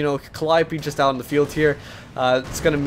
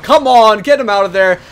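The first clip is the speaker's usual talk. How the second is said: loud, higher-pitched plea to a player; urgent exclamation; 5 semitones higher, 10 dB louder than usual. Real, not acted. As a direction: call out to save the squad. Urges player as squad nearly dies.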